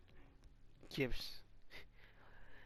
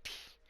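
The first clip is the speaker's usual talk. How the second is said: soft, dismissive tch; tongue-click "tch"; 9 dB quieter than usual. Acted. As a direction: click it quietly through the teeth. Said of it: "Takamura's like".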